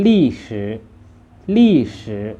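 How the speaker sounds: ลี่สือ as Chinese, loud falling first syllable; Chinese pronunciation. Shown as a demonstration. Teacher models the word for history, Chinese pronunciation of ลี่สือ. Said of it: "ภาษาจีนอ่านว่า".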